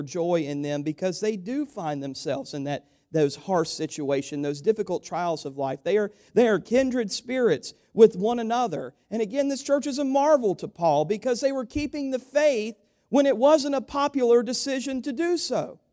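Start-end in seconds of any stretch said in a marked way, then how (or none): 5.8-15.8 rising, louder preacher build; preaching. Acted. Pastor lifting the church with rising fervour.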